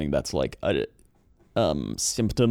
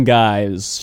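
normal talking voice

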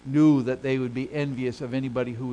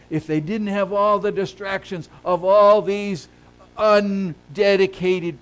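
loud pulpit tirade, punching key words; impassioned, raised preacher's voice; forceful preaching; 6 semitones higher, 10 dB louder than usual. Real, not acted. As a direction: build an indictment with heavy emphasis.

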